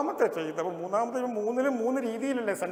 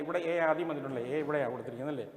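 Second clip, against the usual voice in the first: ordinary delivery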